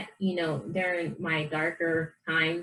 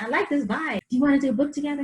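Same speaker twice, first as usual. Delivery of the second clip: higher, keen quoted pitch; eager proposal; 8 semitones higher, 3 dB louder, faster than usual. Retold or imitated. Author retelling her pitch to an influencer, eager and high. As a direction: act out an eager, upbeat invitation.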